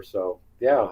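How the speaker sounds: ordinary delivery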